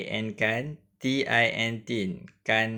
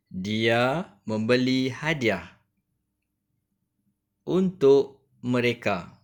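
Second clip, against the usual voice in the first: put-on slow, careful read-aloud for children; slow classroom reading voice; reading aloud to pupils; slower than usual. Shown as a demonstration. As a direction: read it out slowly so the class can follow. Teacher reads story text aloud.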